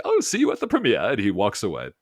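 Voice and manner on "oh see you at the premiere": high airy send-off; breezy, high, lordly farewell; lofty robot voice. Retold or imitated. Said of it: "So he's like".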